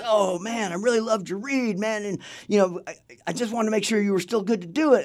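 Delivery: high-pitched, gushing praise; retold gush, pitched well up; enthusiastic director. Director greeting the painter about his audition, excited.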